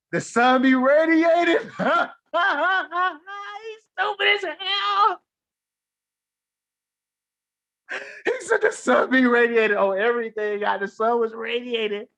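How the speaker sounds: laughing hard. Real, not acted. Laughing at the comment.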